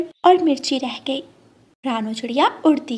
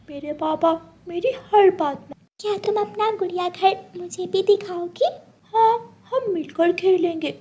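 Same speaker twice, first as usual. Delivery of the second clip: put-on higher, childlike girl's voice; little girl voice; 6 semitones higher than usual. Schoolgirls chatting about a dollhouse, higher childlike voice. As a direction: chatter like an excited young girl.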